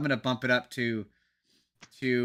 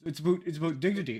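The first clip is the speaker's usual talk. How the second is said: put-on Ontario accent; 3 semitones higher, faster than usual. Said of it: "that's the Ontario accent".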